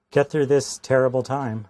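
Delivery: normal talking voice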